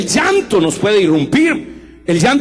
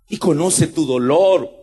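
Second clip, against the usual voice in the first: ordinary delivery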